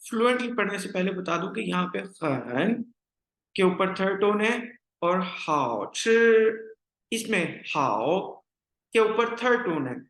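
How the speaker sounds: ordinary talk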